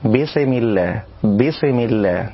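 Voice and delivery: بسم with the s jolted and bounced; bouncing sin. Shown as a demonstration. Teacher demonstrates the wrong sin. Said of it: "من الأخطاء قلقلة السين".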